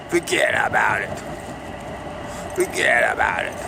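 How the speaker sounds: Brooklyn accent. Shown as a demonstration. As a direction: toss it off like a Brooklyn local.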